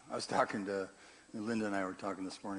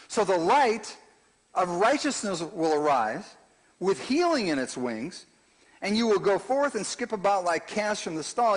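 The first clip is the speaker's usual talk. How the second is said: loud, ringing sermon delivery; preacher thundering scripture and commentary; full-force preaching; 8 semitones higher, 10 dB louder than usual. Acted. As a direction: proclaim it to the whole room.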